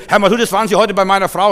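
loud, high, rapid demand; appalled and angry. Retold or imitated. Husband phones the pastor about his wife, appalled and angry. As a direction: imitate an irate caller confronting someone. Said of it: "ganz entsetzt und böse".

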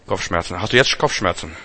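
normal talking voice

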